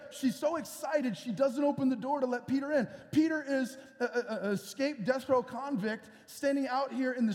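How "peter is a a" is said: ordinary delivery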